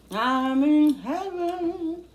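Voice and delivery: high, very slow blissful singsong; ecstatic. Real, not acted. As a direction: stretch it out in pure food bliss. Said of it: "I get very excited over food".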